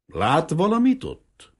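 high, loud, slow shout over roaring water; bellowed slowly into the old man's ear; shouted question. Acted. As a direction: yell it into his ear. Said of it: "úgy ordította a fülébe a kérdést".

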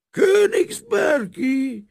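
Königsbergi shouted very high and slow; drunken shout. A narrator's reading. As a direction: bellow Königsbergi in delighted disbelief. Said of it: "Az ácslegény fátyolos szemmel". (részeg, elnyújtott kiáltás: Königsbergi)

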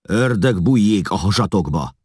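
loud, high angry curse; cursing. A narrator's reading. Eszlasz curses the men at the ferry, angry and loud. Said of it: "káromkodott Eszlasz".

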